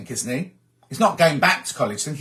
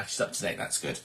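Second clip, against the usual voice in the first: whispered aside; 6 semitones lower, 13 dB quieter than usual. Real, not acted. Host whispers an aside to viewers about Carol, whispering.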